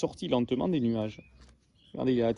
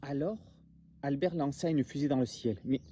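much softer, hushed repeat of the line; quiet re-read; 2 semitones lower, 10 dB quieter than usual. A narrator's reading. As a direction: say it again almost under your breath. Re-reads the same sentence.

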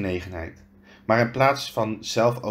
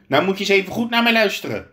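fast, high, loud snap at the giant; snapping; 7 semitones higher, 5 dB louder, much faster than usual. A narrator's reading. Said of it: "snauwde hij". (snauwend, snel en luid)

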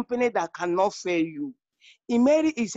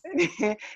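light, high real laugh; amused chuckle; 6 semitones higher than usual. Real, not acted. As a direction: chuckle before you answer. Amused at question about her car.

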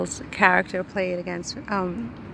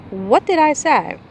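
louder, higher indignant retort; indignant character line; 6 semitones higher, 7 dB louder than usual. Acted. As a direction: snap back, offended. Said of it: "this is Taylor".

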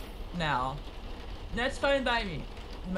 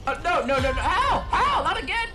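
high pained panicked yelps; terrified horror victim; 9 semitones higher, 5 dB louder than usual. Real, not acted. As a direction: yelp in pain and fear. Player being hit by enemy.

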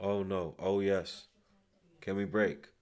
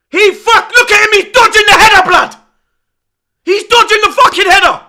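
raging fan shouting at the telly; furious shouting; 3 semitones higher, 5 dB louder than usual. Real, not acted. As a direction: bellow at the defenders in disbelief. Furious rant at ducked header.

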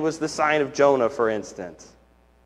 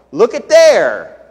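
louder, slow marveling exclaim; mock delighted exclaim; 4 semitones higher, 8 dB louder, slower than usual. Imitating a happy crowd, marveling exclaim. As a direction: marvel aloud like an impressed fan. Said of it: "I don't know".

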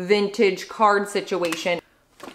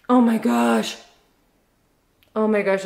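ordinary talk, nothing marked

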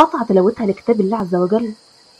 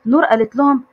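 plain reading voice

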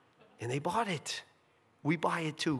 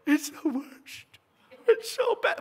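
much higher, drawn-out mock whining; whiny complaint; 10 semitones higher than usual. A congregant complains to the pastor, high mock whine.

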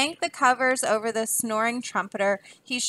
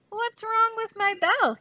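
very high, thin puzzled voice; puzzled murmur; 12 semitones higher than usual. A narrator's reading. Bell ringer baffled by his silent bell, puzzled murmur. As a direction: wonder to yourself in a high old ringer's voice. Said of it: "he murmured".

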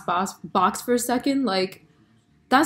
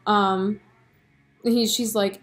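ordinary talk, nothing marked

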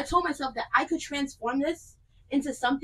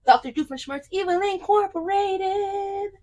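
slow, higher cartoon villain announcement; hammed-up evil scientist; mock villain voice; 7 semitones higher, slower than usual. Villain name in put-on voice.

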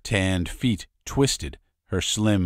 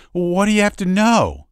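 eager question; 13 semitones higher, 7 dB louder than usual. Acted. Curious listener asks Tolby.

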